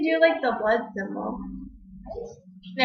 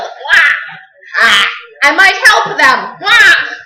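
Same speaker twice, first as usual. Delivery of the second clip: mock panic wail; 6 dB louder, much slower than usual. Girl acting out her friend's panic with mock wails. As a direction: wail like a cartoon victim in panic.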